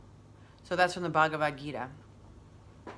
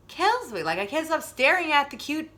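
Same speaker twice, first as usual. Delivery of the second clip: "Kills me" squealed much higher, a bit louder; squealing; 10 semitones higher, 5 dB louder than usual. Real, not acted. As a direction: squeal it, overwhelmed by cuteness. Repeated, squealing at cuteness.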